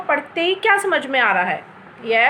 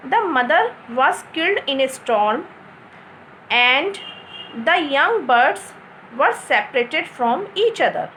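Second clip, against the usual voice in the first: slow and clear, long stops either side of "and"; clear model reading; much slower than usual. Shown as a demonstration. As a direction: pause after "storm", set "and" apart, then finish slowly. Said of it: "इसको ऐसे पढ़ेंगे हम". ("and" पर रुक-रुककर साफ़ पढ़ना)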